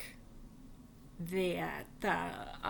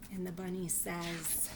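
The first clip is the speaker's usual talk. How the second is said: ordinary delivery, nothing marked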